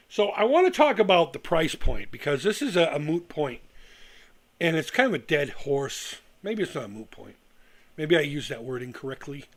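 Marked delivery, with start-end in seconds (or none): none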